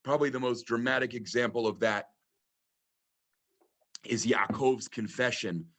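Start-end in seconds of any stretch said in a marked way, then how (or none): none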